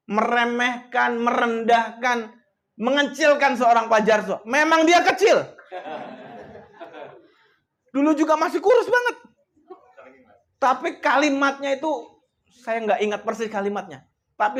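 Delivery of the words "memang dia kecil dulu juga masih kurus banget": laughing. Real, not acted. Joking to the audience about small Pak Jarso, laughing. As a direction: say it through a real laugh.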